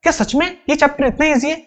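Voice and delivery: a touch of pupil's incredulity; doubting student. Acted. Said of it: "तुम बोलोगे".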